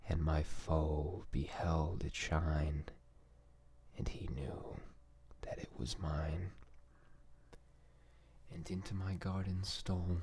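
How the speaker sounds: gentle whispered recitation; calm poem reading. Acted. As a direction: read each line slowly and calmly, lingering on the ends. Reads a poem in ASMR voice.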